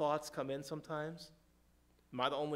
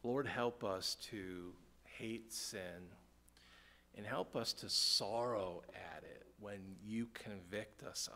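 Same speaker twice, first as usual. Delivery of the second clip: low, soft, slow praying; hushed, earnest plea to God; solemn prayer; 6 semitones lower, 4 dB quieter than usual. Real, not acted. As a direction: pray quietly for the congregation, with pauses. Leads a prayer.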